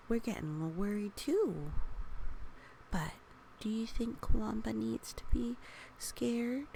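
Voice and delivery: plain reading voice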